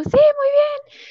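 high and choked up with emotion; dad trying to sound pleased as his voice cracks; nervous proud dad. Retold or imitated. Father hearing the visa news, high and choked. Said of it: "Y me dijo".

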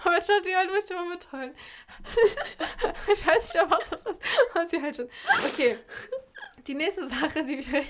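fighting off a giggle fit; giggling. Real, not acted. Sister explains to viewers while giggling uncontrollably.

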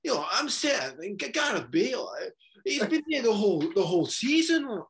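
high, swooping mock pundit voice; exaggerated moaning impression, pitch swinging up high; Souness. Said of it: "he's sitting there in his chair going".

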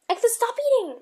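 high loud yell at cat. Real, not acted. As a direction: shout the cat off, exasperated. Scolding cat louder.

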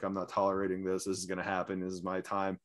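low, soft, fast imitated mutter; muttering under breath. Drunk fan muttering threats during the game, low and soft. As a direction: grumble it to yourself like a brooding drunk. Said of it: "muttering under his breath".

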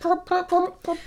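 high, quick popping sounds; primping noise. Shown as a demonstration. Co-host joins in primping her hair aloud, high popping noises. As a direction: voice the pat of hair being primped. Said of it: "that's the noise of someone primping".

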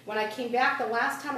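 ordinary talk

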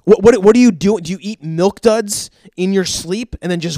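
stammering, loud mock interrogation; exasperated. Dentist grilling patient about candy before bed, exasperated.

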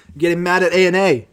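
loud, exasperated rant; getting mad. Real, not acted. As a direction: raise your voice in real exasperation. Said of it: "I'm getting so fucking mad".

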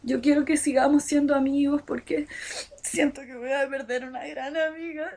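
a hammed-up crying boy begging forgiveness; mock tearful. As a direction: act out his sobbing apology, half-hammed. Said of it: "entre lágrimas".

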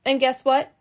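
usual speaking voice

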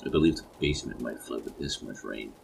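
ordinary delivery, nothing marked

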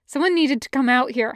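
plain reading voice

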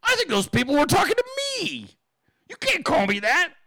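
high, fast, indignant imitation; mock Bill Mitchell. Mocking Bill Mitchell realizing insults, high indignant. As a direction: squeal it like a startled old man. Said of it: "Bill Mitchell's like".

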